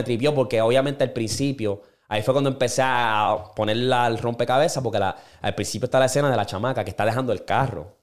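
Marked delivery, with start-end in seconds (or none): none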